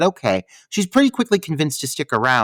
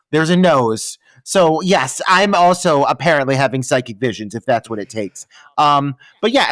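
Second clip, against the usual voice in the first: normal talking voice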